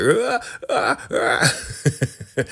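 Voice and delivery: high hammed-up "ah" cries; imitated high wordless squawks; mock outraged shriek. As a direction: shriek in short high bursts, mimicking outrage. Mimics furious Elder Mother's shrieks.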